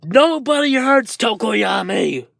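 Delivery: high, drawn-out furious cry; protective monster yell. Acted. Dark Shadow defends Tokoyami from All Might, furious yell. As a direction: yell it slowly, fiercely protective.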